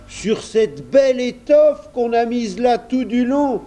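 high, astonished countryman; incredulous amazement. A narrator's reading. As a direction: balk in disbelief at such waste. Kersac amazed by the carpet.

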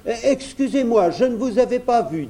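put-on high boyish character voice, feigned innocence; polite little prince. A narrator's reading. Prince excuses himself to the bear, politely. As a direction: apologise lightly in a high voice.

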